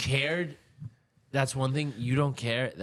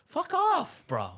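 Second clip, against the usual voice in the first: retold brush-off; 11 semitones higher, much slower than usual. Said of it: "I'm like".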